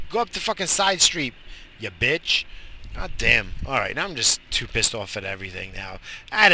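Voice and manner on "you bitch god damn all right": normal talking voice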